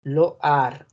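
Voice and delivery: Loar with the oi as oa; French pronunciation. Shown as a demonstration. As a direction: model the oa sound. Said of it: "Esta I se pronuncia como una A". (Loar con la i como a)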